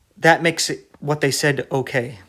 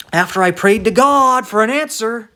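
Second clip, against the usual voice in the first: mock believer; 6 semitones higher, 4 dB louder than usual. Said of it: "mocking a believer's claim".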